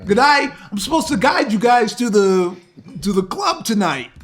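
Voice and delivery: guide character voice. Acted. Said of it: "here we go, here we go".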